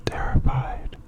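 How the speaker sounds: ordinary delivery, nothing marked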